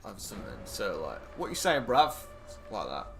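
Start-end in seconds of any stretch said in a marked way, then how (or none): 1.4-2.1 higher, louder London street delivery; Cockney accent. Shown as a demonstration. Showing how bruv ends a sentence, Cockney accent. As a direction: throw it out in a lightly put-on Cockney. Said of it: "It's Cockney accent, like a London accent".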